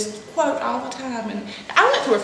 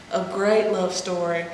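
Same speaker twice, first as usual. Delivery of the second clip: ordinary delivery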